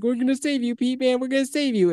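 high, imitated tearful plea; a retold Harry, high and pleading as if crying; mock crying plea. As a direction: pretend to beg through tears. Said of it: "crying and being like".

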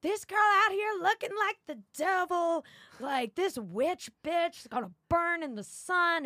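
high, loud mock yelling at a passerby. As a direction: shout it like a street heckler, hammed-up. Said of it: "they're yelling at me".

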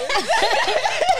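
high, loud burst of laughter. Real, not acted. Teased by her friend, bursting into high laughter. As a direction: cracks up high. Teased about being a Cancer.

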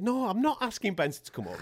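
high-pitched, incredulous no; exasperated refusal. Retold or imitated. Presenter refusing the boxer's repeated request, exasperated. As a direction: refuse as if worn down by the nagging.

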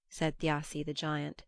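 usual speaking voice

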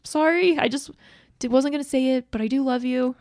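calling back to say I love you, high and apologetic; high apologetic gush. Retold or imitated. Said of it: "calling back now and being like".

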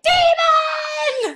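high, drawn-out horror shriek; mock terrified scream. Loved one seeing a cat write, mock terrified scream. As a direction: scream it like you've seen a possessed cat. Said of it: "just be like".

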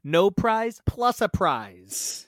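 "No prize plus a prize" said slowly, every word spaced out; slowly and carefully, nailing "No prize plus a prize"; slow careful retry. Shown as a demonstration. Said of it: "I can't even say it".

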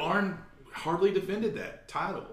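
slow, high Southern drawl on title; Southern accent. Retold or imitated. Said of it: "where'd my Southern accent come from?".

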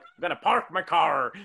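loud mock American with piratical Rs; hammed-up American accent, every R exaggerated; pirate-like American accent. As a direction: growl the Rs in "park" and "car" like an American pirate. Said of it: "sound like pirates 'cause everything's R".

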